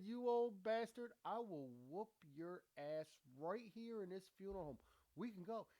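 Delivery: hammed-up fighting words; mock fighting challenge.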